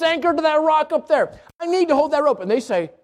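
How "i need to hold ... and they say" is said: normal talking voice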